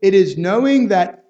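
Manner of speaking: fervent preacher building intensity; impassioned preaching. Acted. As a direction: drive each question home to the congregation. Preaching climax on rejecting truth.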